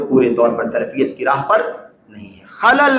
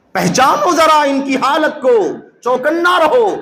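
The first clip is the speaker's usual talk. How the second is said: urgent warning; 9 semitones higher, slower than usual. Real, not acted. Preacher warns congregation urgently.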